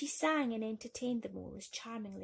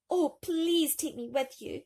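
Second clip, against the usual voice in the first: pleading cry; 7 semitones higher, 8 dB louder than usual. A narrator's reading. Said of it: "cried Thumbelina".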